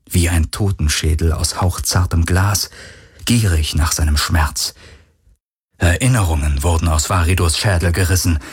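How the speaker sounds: usual speaking voice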